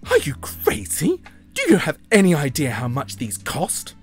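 high, loud scandalised diva protest; indignant put-on girl voice; outraged disbelief. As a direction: exclaim it aghast, with stretched emphasis. Phoebe appalled at losing shoes.

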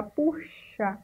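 puxar said softly, stretching the CH sound and the final A; stretched model word. Shown as a demonstration. Teacher asking pupils if they hear CH in puxar, soft and drawn out. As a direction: hold puxar out so the class hears its CH. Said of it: "Percebem aí um som de C H".

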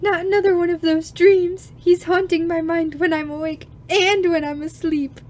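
put-on high, loud fretful girl; anguished waking heroine; dramatic heroine. Voicing the dreaming girl.

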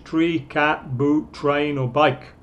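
ordinary talk, nothing marked

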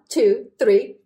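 usual speaking voice

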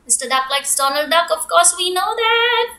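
ordinary delivery, nothing marked

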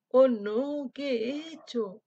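loud cry, then slow question; howling dismay. Acted. Wolf fears the lamb is eaten, howling dismay. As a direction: wail over the lost lamb. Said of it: "aulló el lobo".

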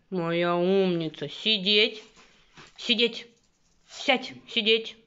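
loud, slow coaxing dog command; talking to a dog. Real, not acted. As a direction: coax the dog to sit, slowly.

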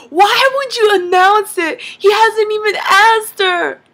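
high, loud mock scolding; heated protest, acting out a brother; imitated outraged brother. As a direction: scold him in exasperated disbelief. Brother scolds dad, acted out.

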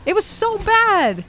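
slow, high, drawn-out complaint; really milk how bad it was; exaggerated disgust. Real, not acted. Ranting about Man of Steel.

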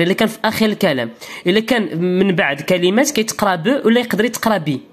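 ordinary delivery

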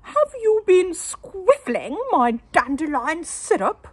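high, loud genteel lady voice; fussy wife, voiced high and loud; prim wife. A narrator's reading. Wife chiding her husband, high and loud. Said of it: "she said to her husband".

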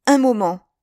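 high, loud call to halt; exclaimed. A narrator's reading. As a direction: call out to stop them. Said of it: "s'écria d'Artagnan".

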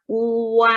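"อัว" stretched long, oo sliding into a; blended vowel demonstration. Shown as a demonstration. Teacher showing how two sounds combine, long gliding "อัว". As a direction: blend oo and a into one drawn-out "อัว". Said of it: "อูกับอาก็เป็นสระอัว".

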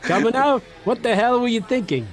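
high-pitched mock British outburst; put-on British indignation; hammed-up British accent. Shown as a demonstration. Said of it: "Can you do a British accent?".

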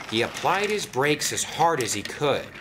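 ordinary delivery, nothing marked

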